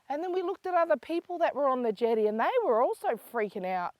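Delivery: ordinary delivery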